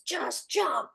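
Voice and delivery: high, quick dare; daring inner voice. Retold or imitated. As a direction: tell yourself to leap. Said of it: "This voice goes".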